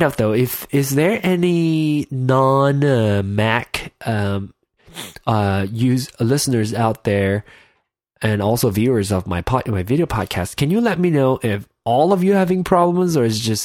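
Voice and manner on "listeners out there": usual speaking voice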